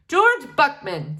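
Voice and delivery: slow, loud formal self-introduction; dazed father. Acted. Father in pajamas greeting the children, slow and formal. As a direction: announce his own name grandly.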